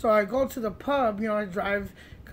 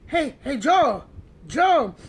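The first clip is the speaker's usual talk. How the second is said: louder call to a friend; raised voice, calling for attention; 5 semitones higher, 8 dB louder, slower than usual. Retold or imitated. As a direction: hail someone by name from a car.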